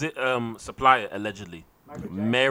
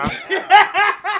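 laughing; 4 dB louder than usual. Real, not acted. Laughing high voice.

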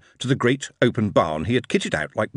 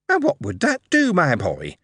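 stern mother; 7 semitones higher than usual. Acted. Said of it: "said his mother sternly".

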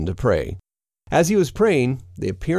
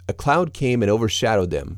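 usual speaking voice

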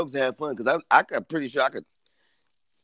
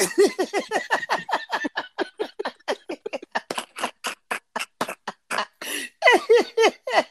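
long, very high wheezing cackle; real helpless laughter. Real, not acted. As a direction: lose it completely laughing. Cracking up at own rule.

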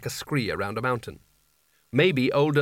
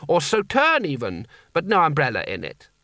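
put-on old sultan voice; 3 semitones higher than usual. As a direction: ask like a thirsty sultan. Creosote voiced by narrator.

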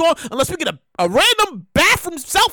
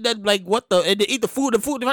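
usual speaking voice

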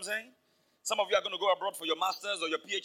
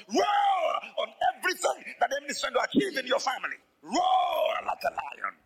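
loud, slowed, roaring climax; full-force preaching shout; 5 semitones higher, 3 dB louder than usual. Real, not acted. Preacher urging the congregation to roar like lions, full shout. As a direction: roar it out at the church. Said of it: "Roar in the place of prayer".

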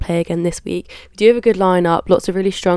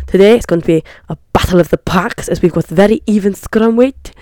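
mock South African accent; 5 dB louder than usual. Vlogger analysing the forward packs, loud mock South African accent. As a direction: slip into a bad South African commentator. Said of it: "I'm going into a South African accent now".

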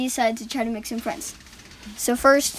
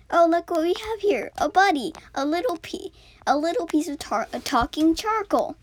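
lightly voiced excited kid; 6 semitones higher than usual. American boy marvels at the talking charcoal. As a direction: coo over a new little buddy.

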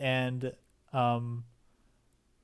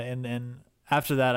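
ordinary talk

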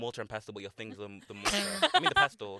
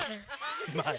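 mock squeaky claim; 13 semitones higher, 4 dB quieter, much slower than usual. Mock repeat of Irish claim.

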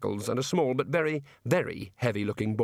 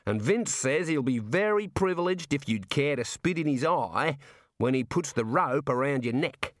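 jovial Aussie warder; 4 semitones higher than usual. Acted. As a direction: play a chummy outback prison guard. Warder relays hangman's wish.

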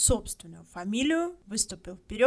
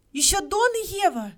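much higher, loud shout; woman shouting the names; 11 semitones higher, 10 dB louder than usual. A narrator's reading. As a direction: cry out desperately. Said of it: "закричала миссис Хатчинсон".

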